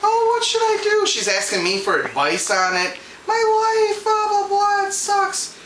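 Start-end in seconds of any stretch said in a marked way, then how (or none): 0.0-1.0 high-pitched mock wail; mock sobbing. Sobbing customer asks the clerk for advice, mock wailing. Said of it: "And she's sobbing in front of me". 3.3-5.5 high, slow mock blubbering; mock sobbing. Sobbing customer bemoans her life to clerk, mock wailing. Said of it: "And she's sobbing in front of me".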